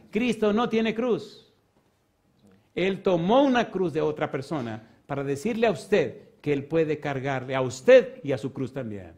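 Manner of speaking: slow, loud, high-pitched preaching; preacher proclaiming scripture to the church, slow and loud; full-force preaching. Acted. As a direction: thunder the verse out to the congregation. Preaching the cross passage.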